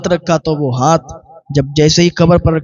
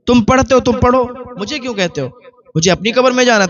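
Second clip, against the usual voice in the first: mocking retort; 6 semitones higher than usual. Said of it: "مذاق بناتی تھی".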